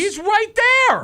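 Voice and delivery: high, loud disbelief; can't believe it, yell it; incredulous shout. Real, not acted. Disbelief at missed chance.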